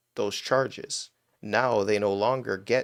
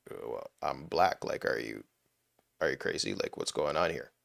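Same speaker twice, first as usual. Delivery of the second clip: mock indignant voice; 7 semitones lower, 5 dB quieter than usual. Joking self to viewers, low deadpan mock indignation. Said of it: "no, I'd be like".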